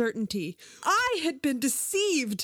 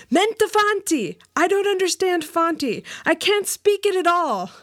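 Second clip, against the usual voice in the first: high, emphatic protest; crying out; 6 semitones higher, 3 dB louder than usual. A narrator's reading. Customer using humor to discourage the suitor, crying out. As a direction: cry out in mock-desperate denial. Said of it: "I cried out in the native tongue".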